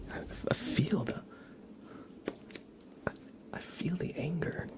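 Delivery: light teasing unvoiced whisper. Real, not acted. Streamer teasing Clyde about his anger, whispering. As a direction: tease him softly on the breath.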